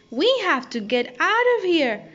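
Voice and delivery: high, urgent cry to flee; cried out. A narrator's reading. Monkey cries out to flee the pirate ship, urgent. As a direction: sound the alarm, pitch climbing. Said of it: "cried Chee-Chee".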